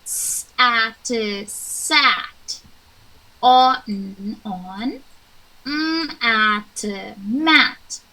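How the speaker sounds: slow sounded-out letters then the word; phonics blending. Shown as a demonstration. Teacher sounding out letters for two kids, slow and clear. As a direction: sound out each letter for the children.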